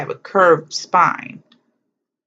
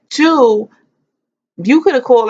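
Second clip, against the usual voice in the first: indignant scolding; 7 semitones higher, 6 dB louder than usual. Real, not acted. Scolding her absent godmother.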